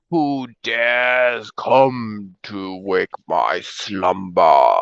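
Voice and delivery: slow, low booming ancient dragon; grinding stone statue. Acted. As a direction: rumble it out like waking stone. GM voices waking dragon.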